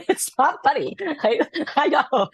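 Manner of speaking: high, giggly protest while trying not to laugh; stifle laughter while insisting it's not funny. Real, not acted. Telling a friend about the drunk seller, laughing.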